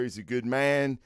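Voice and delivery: ordinary talk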